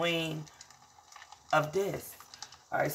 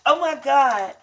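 excited; 9 semitones higher, 8 dB louder, slower than usual. Real, not acted. Excited outburst between sung lines.

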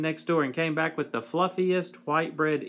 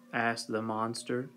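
ordinary delivery, nothing marked

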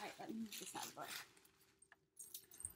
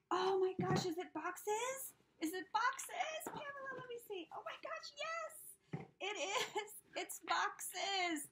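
much higher and louder, squealing with joy; breaking into a happy laugh-cry at the end; thrilled squeal; 12 semitones higher, 8 dB louder than usual. Real, not acted. Opening a longed-for gift.

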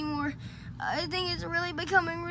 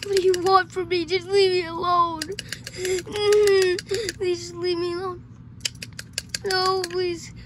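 loud, tearful begging; acted crying plea; desperate pleading; 3 semitones higher, 7 dB louder than usual. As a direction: beg loudly to be left alone. Begs the creature to leave.

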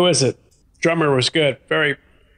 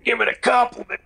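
strained, breath held in, higher; holding breath; 8 semitones higher than usual. Real, not acted. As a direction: talk while holding your breath. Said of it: "I'm holding my breath and talking weird".

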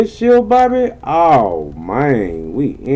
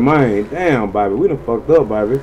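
ordinary delivery

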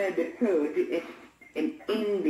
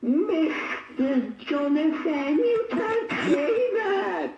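high, slow, whining protest with a laugh in it; the girl squeals a protest at her swearing teacher, high and whining; shocked whiny schoolgirl; 8 semitones higher, slower than usual. Acted. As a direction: wail at the teacher like an outraged little girl.

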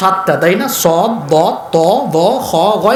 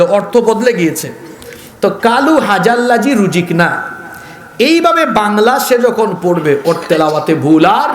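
normal talking voice

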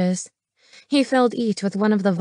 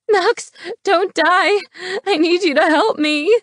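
desperate plea; 10 semitones higher, 4 dB louder than usual. Acted. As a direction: beg a dying man to hold on, voice pitched way up. Woman begs dying man for help.

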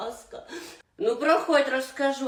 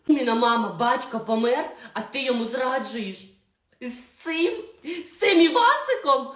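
outraged shout; 2 semitones higher, 5 dB louder than usual. Acted. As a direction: blast your mother for betraying dad. Daughter shocked at mother.

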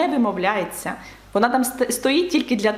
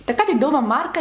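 normal talking voice

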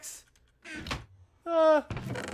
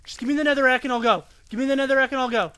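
usual speaking voice

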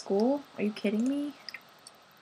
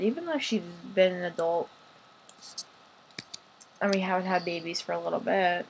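ordinary delivery

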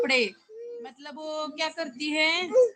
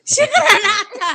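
Shikhar shouted much louder and higher with a laugh; insistent shout; 8 semitones higher, 14 dB louder than usual. Real, not acted. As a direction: push Shikhar out loud, laughing. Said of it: "शिकर की". (हसत हसत मोठ्याने 'शिकर')

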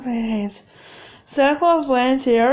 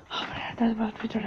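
hushed breathy whisper; 5 semitones lower, 11 dB quieter, faster than usual. Acted. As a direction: whisper so he won't hear. Hiding, fearful whisper.

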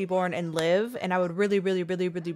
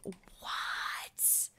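whisper; 12 dB quieter than usual. Real, not acted. Surprise at game event.

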